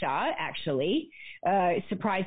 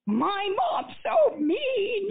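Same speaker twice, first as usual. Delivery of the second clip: high, slow wail through tears; a child sobbing out the unfairness; slow, high, tearful cry; crying complaint; 12 semitones higher, much slower than usual. Retold or imitated. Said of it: "and now I'm really crying".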